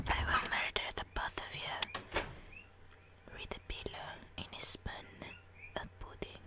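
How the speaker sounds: very soft, breathy muttering; whispered threat. Acted. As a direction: whisper menacingly, fading to almost nothing. Whispered threat turning to nonsense.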